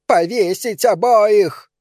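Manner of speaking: a hateful woman screaming for a hanging; shrill, furious howl from the crowd; screeching yell. A narrator's reading. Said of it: "завопила она".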